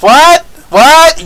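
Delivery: loud, high, rising outburst; angry bafflement. Acted. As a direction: act out an exasperated viewer's cry. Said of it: "now I'm angry".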